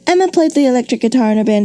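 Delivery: high, fast run-on read; rushed example sentence. Shown as a demonstration. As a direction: hurry the clause toward the next. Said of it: "if you have a run-on sentence like".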